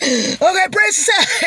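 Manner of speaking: high, loud mock alarm; mock bracing. Imitating a rider bracing at the peak, high and loud. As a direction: brace for the drop in a high, loud voice. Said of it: "it's like".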